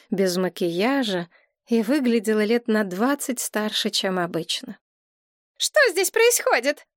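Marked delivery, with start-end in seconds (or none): 5.6-6.9 high-pitched hysterical exclamation; frightened, hysterical. A narrator's reading. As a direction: cry out in fright, pitch leaping up. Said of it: "воскликнула она испуганным истерическим голосом".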